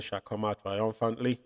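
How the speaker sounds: ordinary talk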